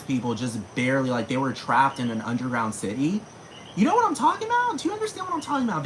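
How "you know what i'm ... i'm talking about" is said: high squeal. Real, not acted. Squeaky rhetorical outburst.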